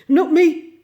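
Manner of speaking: high, loud jolt awake; startled denial. Acted. Rat jerking awake and denying he slept. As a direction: blurt it out just woken. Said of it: "waking up with a jerk".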